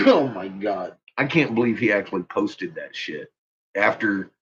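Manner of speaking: ordinary talk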